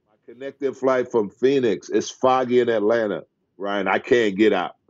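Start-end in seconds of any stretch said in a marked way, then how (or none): none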